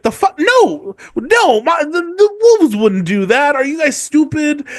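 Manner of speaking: put-on high, outraged kid voice; indignant girl.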